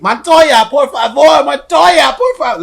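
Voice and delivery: high-pitched imitation of a yelling host; mock host yelling. Retelling the host calling to Montoya, mock yelling. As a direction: yell it high like the pleading host. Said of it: "host kept yelling".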